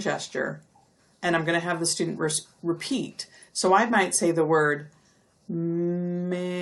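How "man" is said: "man" drawn out very slowly; stretched slowly. Shown as a demonstration. Teacher stretch segmenting a word for kids, very slowly. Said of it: "say the word very slowly".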